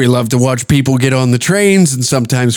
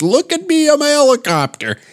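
put-on much higher, giddy childish showing off; silly showoff; 11 semitones higher than usual. Drama teacher showing off to the kids, high giddy boast. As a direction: crow it like a kid showing a trick. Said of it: "and he says".

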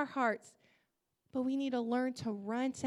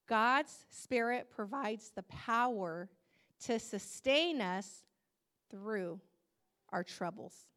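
slow emphatic reading; 3 semitones lower than usual. Shown as a demonstration. Pastor drawing the church in, slow and emphatic. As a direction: lean on each word slowly. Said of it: "Listen to this".

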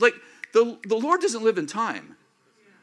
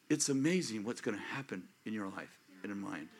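normal talking voice

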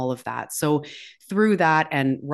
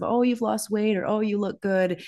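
lightly voiced gushing admirer, higher pitch; mock compliments; 8 semitones higher than usual. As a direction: chirp the compliments like an impressed friend. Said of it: "accolades of".

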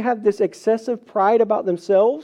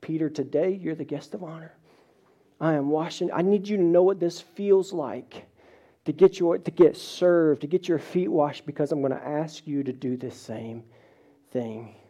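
softer, hushed, gently reassuring; tender Jesus; 3 semitones lower, 5 dB quieter than usual. Retold or imitated. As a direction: speak it tenderly to a friend.

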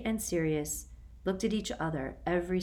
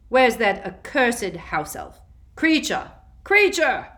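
higher, louder, slow angry calling; shouting; 5 semitones higher, 11 dB louder, slower than usual. Acted. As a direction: bellow across the house for the elf. Calling for the house elf.

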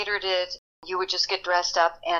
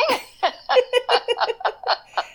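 high, bubbling laugh; delighted laugh; 3 dB louder than usual. Real, not acted. Storyteller enjoying her starstruck memory, delighted laugh. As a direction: laugh at your own fond anecdote.

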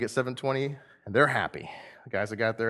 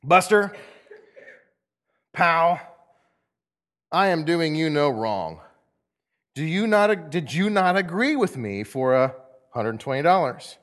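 loud, higher rebuke, slow and emphatic; stern master; 4 semitones higher, 5 dB louder, slower than usual. Acted. Master to grumbling worker at the pay line, stern. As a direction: lay down the law, pausing after each address.

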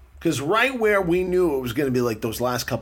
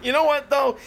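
said through a laugh; 8 semitones higher than usual. Real, not acted. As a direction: laugh while asking. Laughing reply.

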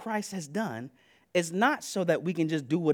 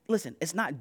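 normal talking voice

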